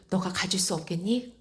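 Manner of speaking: soft, earnest question; quietly imploring; tender plea. Retold or imitated. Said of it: "너무나 간절하게 말씀을 하시는 거예요".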